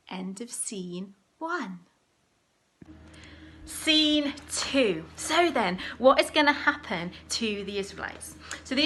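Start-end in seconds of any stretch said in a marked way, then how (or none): 0.1-1.8 soft whispered scene ending; whispering the end of scene one to the children. Shown as a demonstration. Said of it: "announces scene end in whisper". 3.6-5.0 announcing scene. Shown as a demonstration. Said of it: "announces new scene".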